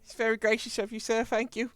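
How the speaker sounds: high, grateful servant voice; deferential servant. Acted. Servant thanking sir for sparing the carrying, high and deferential. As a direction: thank the master in a high little voice.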